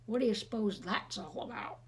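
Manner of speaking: usual speaking voice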